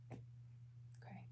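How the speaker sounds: a quick whispered okay off to the side; whispered aside. Real, not acted. Psychic reader whispers to someone off camera, whispering. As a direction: breathe it without voice.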